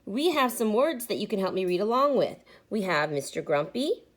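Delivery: ordinary delivery, nothing marked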